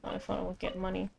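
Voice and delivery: very soft, low-energy muttering; hushed, half-swallowed grumble; grumbling aside. Acted. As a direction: mumble it under your breath as a disgruntled aside. Grumbling aside about the money.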